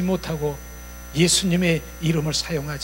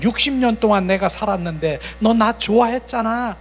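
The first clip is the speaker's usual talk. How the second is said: high, insistent arguing; indignant protest; 7 semitones higher, 7 dB louder than usual. Acted. Evil spirit arguing with its longtime host, indignant protest. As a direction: reproach an old companion in a heated run.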